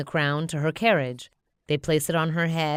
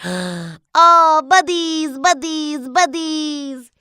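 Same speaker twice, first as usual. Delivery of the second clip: much higher, louder overjoyed minion; happy minion squeal; 11 semitones higher, 10 dB louder, much slower than usual. Acted. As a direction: gush at the yellow guards. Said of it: "Bob sighs happily".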